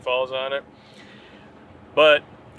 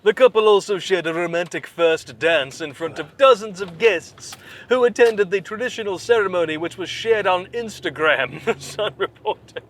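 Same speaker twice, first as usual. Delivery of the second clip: mock news read; 5 semitones higher than usual. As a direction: read the gossip like a breathless reporter. Reads article in mock voice.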